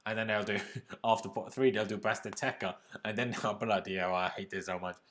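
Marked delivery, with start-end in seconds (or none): none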